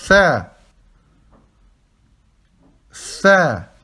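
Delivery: Tha with the tongue between the teeth. Shown as a demonstration. Teacher modelling Tha for students, tongue between teeth. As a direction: lisp the Tha clearly. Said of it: "la langue entre vos dents".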